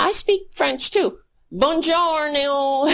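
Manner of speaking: lightly mocking high voice, faux-Italian greeting; a hint of a clueless show-off; mock Melania. Mocking Melania showing off her languages, mock high voice. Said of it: "That's my Italian".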